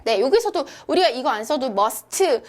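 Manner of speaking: usual speaking voice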